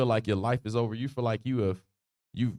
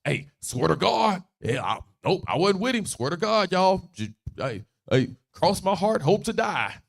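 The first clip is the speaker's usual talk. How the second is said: high-pitched, hammed-up swearing of innocence; emphatic oath; 6 semitones higher than usual. Peter denying it with an oath, insistent mock protest. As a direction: swear it up and down like a kid. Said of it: "He took it to another level".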